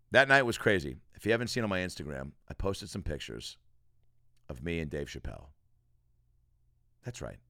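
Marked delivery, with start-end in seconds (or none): none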